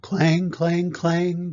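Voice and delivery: loud, high ringing clangs; clanging bell. A narrator's reading. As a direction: punch out each clang like a fire bell. Imitates fire engine bell in story.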